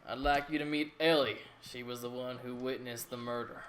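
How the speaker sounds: loud hearty country governor; a jovial governor calling out; country accent. Acted. Governor voiced by GM.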